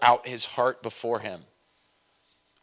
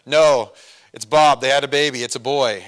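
loud quick message; 6 dB louder than usual. Retold or imitated. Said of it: "caller shouting message in skit".

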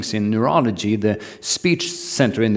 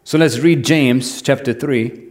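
ordinary talk, nothing marked

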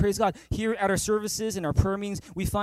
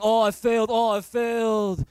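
mock self-pity; 2 semitones higher, 4 dB louder than usual. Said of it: "instead of saying".

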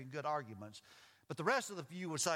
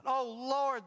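raised, louder cry to God in narration; pleading; 9 semitones higher, 7 dB louder than usual. A narrator's reading. Sailors crying out to the Lord, pleading. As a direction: call out in appeal. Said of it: "they pleaded".

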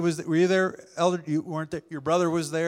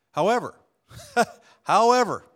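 laughing however; 8 semitones higher, 6 dB louder than usual. Real, not acted.

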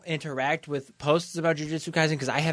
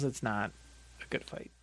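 drops much softer, trailing off; fading out; 7 semitones lower, 13 dB quieter than usual. Real, not acted. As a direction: let the line die away. Punchline trailing off.

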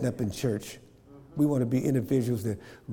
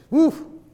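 whooping yelp; 7 dB louder than usual. Acted. Pulling teeth comparison.

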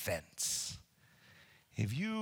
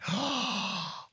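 gasp; 6 semitones higher than usual. Retold or imitated. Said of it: "would have gone".

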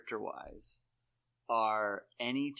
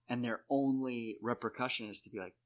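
ordinary talk, nothing marked

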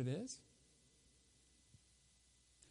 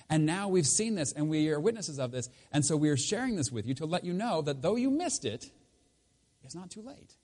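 louder, higher testimony; fervent preaching; 5 semitones higher, 6 dB louder than usual. Acted. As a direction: proclaim it like a witness. Preaching build.

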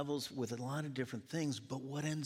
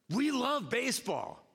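fan enthusiasm; 9 semitones higher, 3 dB louder than usual. Retold or imitated. Acting out fans' claims.

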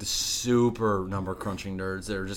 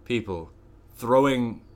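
normal talking voice

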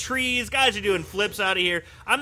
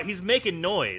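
normal talking voice

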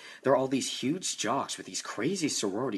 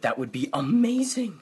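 excited; 7 semitones higher, 8 dB louder than usual. Acted. Excited encouragement.

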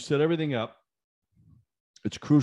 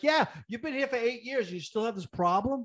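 high mocking echo; sarcastic; 10 semitones higher, 3 dB quieter than usual. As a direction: throw his words back at him. Retold sarcastic reply to manager.

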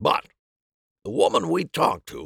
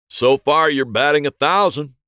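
loud, hearty cop voice; a pleased homicide detective; gruff detective; 5 semitones higher, 9 dB louder than usual. Acted. Detective approves loudly.

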